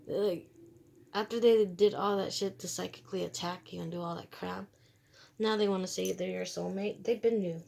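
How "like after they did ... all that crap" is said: ordinary talk, nothing marked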